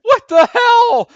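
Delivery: slow, high, loud laughing cry; real incredulous yell through laughter; drawn-out disbelief. Real, not acted. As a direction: stretch the words out in amazement.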